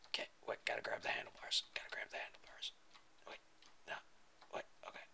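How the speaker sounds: hushed whisper to himself. Real, not acted. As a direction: whisper it under your breath.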